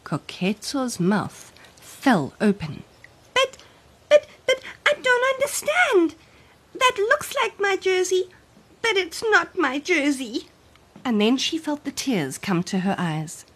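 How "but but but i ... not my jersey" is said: put-on high, stammering child voice; confused little girl. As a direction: stumble over the words in disbelief. Child confused by shrunk jersey.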